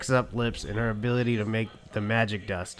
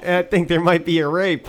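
higher, louder tattletale voice; Webagal; 6 semitones higher, 7 dB louder than usual. Acted. Webagal reporting trouble to Uncle Scroogey, raised alarmed voice. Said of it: "Rebagal came running in".

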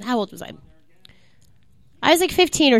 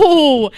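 loud, drawn-out "oh"; shocked cry; 5 dB louder than usual. Real, not acted. Player catches a friend swearing, shocked shout. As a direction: shout out in scandalized surprise.

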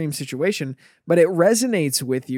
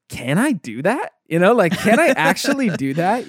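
incredulous disbelief; 7 semitones higher, 4 dB louder than usual. Retold or imitated. Retelling own past disbelief.

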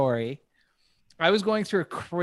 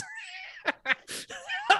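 laugh; 5 dB quieter than usual. Real, not acted. Laughing at his own story.

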